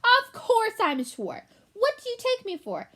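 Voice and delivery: high, loud indignant rat; offended. Acted. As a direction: puff up in wounded pride. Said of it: "Looking offended".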